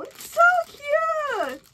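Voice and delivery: high, slow delighted squeal. Real, not acted. Unboxing a gift, squealing high over how cute.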